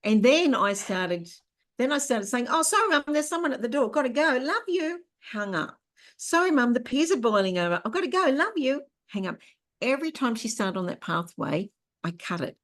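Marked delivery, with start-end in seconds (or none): 2.5-5.0 high, sing-song brush-off to mum; chirpy fake apology to get off the phone; sweet fake excuse. Daughter cutting off her mum's call, brightly high and sweet. 6.2-8.8 high, sing-song brush-off to mum; chirpy fake apology to get off the phone; sweet fake excuse. Daughter cutting off her mum's call, brightly high and sweet.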